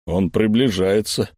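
nervous bass from the pipe; nervous bass through a tube. Acted. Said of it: "нервно пробасил невидимка".

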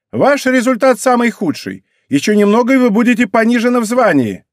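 high, loud military scolding; sergeant's reprimand. A narrator's reading. Рейка dresses down her corporal, loud reprimand. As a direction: threaten the corporal like a drill sergeant.

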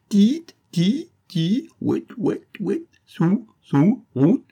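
high loud angry chirps through a gag; enraged gagged squeaking; furious twittering. A narrator's reading. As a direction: twitter with rage like a caged bird. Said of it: "gazouillait-il avec rage".

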